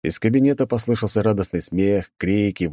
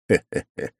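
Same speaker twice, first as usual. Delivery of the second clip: sly chuckle; 3 semitones lower than usual. Acted. Hero's scheming chuckle.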